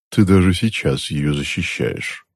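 slow, mildly grumbled reproach; a touch of disgruntled aunt; grumbling. A narrator's reading. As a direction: grumble it under the breath, slowly. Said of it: "проворчала тётушка".

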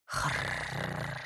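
low soft gurgling wheeze; a dead throat rattling; undead death rattle. Acted. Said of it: "Голова захрипела".

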